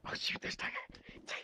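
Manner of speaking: hushed whisper, barely voiced; startled hiding whisper. Real, not acted. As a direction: whisper so they don't hear you. Hiding from players in game.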